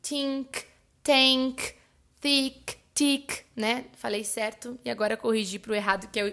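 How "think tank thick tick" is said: "think" said as "tink", "tank" and "tick" with a hard T in place of TH; overdone T for TH: "think", "tank", "thick", "tick". Shown as a demonstration. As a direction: put a firm T where the TH goes in "think", "thick". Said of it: "falar bem o som de T".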